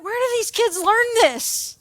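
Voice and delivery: high, bewildered question; shocked disbelief. Retold or imitated. Shaken teacher asking about lying students, bewildered. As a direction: wonder aloud in high-pitched disbelief. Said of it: "I'm just shook".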